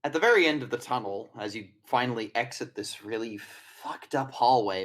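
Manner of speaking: normal talking voice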